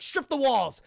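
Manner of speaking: loud, high demand; acted urgent yell; frantic order. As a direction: order it in a paranoid frenzy.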